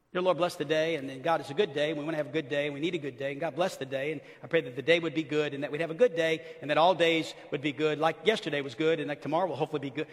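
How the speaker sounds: low, rambling mock prayer; generic prayer. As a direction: run the phrases together by rote. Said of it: "we pray these generic prayers".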